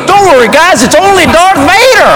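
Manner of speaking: high, upbeat clown reassurance; high-pitched hype-man voice. Retold or imitated. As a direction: call it out cheerily like a party clown. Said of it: "the clown, who's my hype man".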